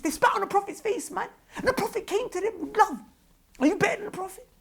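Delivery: high-pitched, sustained impassioned plea; impassioned rebuke. Real, not acted. Preacher invoking the Prophet's mercy to critics, impassioned. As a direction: voice leaping very high with emotion.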